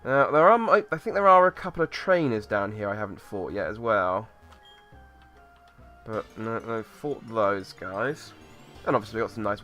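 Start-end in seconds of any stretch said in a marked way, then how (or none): none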